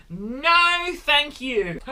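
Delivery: drawn-out, deliberate no thanks; slow refusal. Real, not acted. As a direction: stretch every word out. Turning down dating, very slow.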